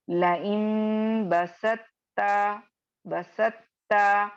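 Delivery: "लइन बसत्ता" slow, तो dropped, ता doubled; recitation demo. Shown as a demonstration. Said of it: "तो की आवाज बिल्कुल नहीं आएगी". (धीमी तिलावत, तो को ता में मिलाकर)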